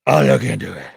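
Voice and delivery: low gravelly growl, loud on the second; low rasping vigilante growl. Retold or imitated. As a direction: growl it like Bale's Batman.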